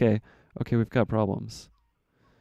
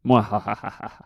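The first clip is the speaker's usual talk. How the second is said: hammed-up evil laugh; mad scientist. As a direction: cackle like a villain. Gloats over the chicken trap.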